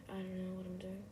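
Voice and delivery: ordinary talk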